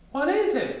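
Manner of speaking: high, urgent question; demanding Piglet. Acted. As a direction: press for an answer. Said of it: "Piglet demanded".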